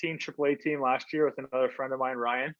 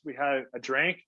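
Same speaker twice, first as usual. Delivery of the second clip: ordinary talk, nothing marked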